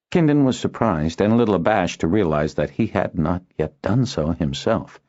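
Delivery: ordinary delivery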